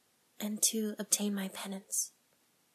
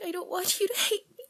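pleading on the edge of crying; tearful pleading; 8 semitones higher than usual. Acted. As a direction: voice breaking into a high sob. Begging priest not to hate her.

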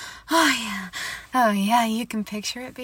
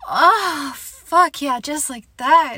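high loud ecstatic cries; loud moans; 4 semitones higher, 7 dB louder, slower than usual. Acted. To her partner during sex, crying out loudly. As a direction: cry out in pleasure.

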